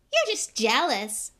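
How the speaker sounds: put-on slow, high, loud boyish jibe; indignant little boy; piping up. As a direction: pipe up in defense of a hero. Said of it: "piped up Colin".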